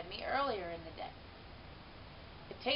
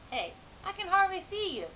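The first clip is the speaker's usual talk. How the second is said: higher, cheerful janitor voice; lightly voiced friendly man peering in; 10 semitones higher, 3 dB louder than usual. A narrator's reading. Janitor leaning to the cage, lightly voiced and cheerful.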